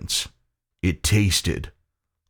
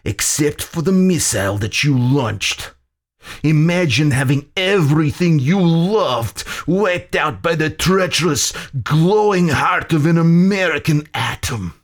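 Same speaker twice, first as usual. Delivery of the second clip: impassioned, high, rising accusation from a villain; embittered Soviet general; 8 semitones higher, 3 dB louder than usual. A narrator's reading. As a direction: deliver a bitter villain's speech, pitched up.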